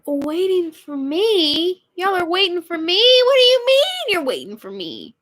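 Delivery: high-pitched, escalating disbelief; incredulous. Real, not acted. Puzzled host to guests supposedly waiting for her, incredulous.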